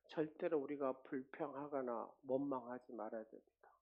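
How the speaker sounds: ordinary delivery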